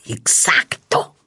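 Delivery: low breathy whisper. Acted. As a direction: whisper it like a secret. Sudden aside in the act.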